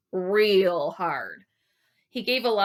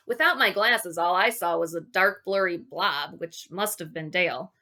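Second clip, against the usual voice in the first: plain reading voice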